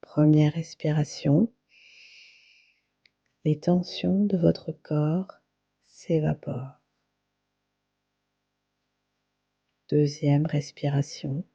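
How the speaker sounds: calm, unhurried yoga nidra guidance with long pauses; guided meditation. Acted. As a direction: guide them gently into relaxation, phrase by phrase. (voix posée de méditation guidée, avec de longues pauses)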